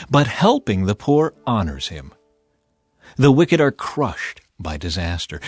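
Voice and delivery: normal talking voice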